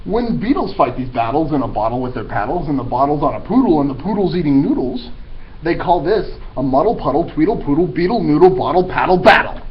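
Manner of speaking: bouncy tongue-twister rhythm; rhyming read-aloud. A narrator's reading. Narrator building a silly rhyme to a finish.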